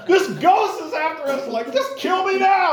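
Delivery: high, loud mock shriek with a laugh; shrieking in fear. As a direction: squeal like a terrified boy. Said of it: "it means a shriek or a shrill".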